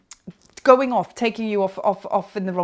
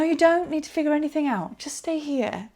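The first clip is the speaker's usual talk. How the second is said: ordinary talk, nothing marked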